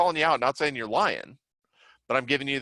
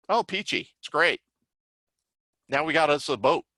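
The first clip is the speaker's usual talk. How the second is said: mock sarcastic survivor, a touch brighter; tossing off peachy with light irony; sarcastic reply; 4 semitones higher than usual. Survivor mocking a helper's question, mildly sarcastic. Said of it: "you even want to be careful with".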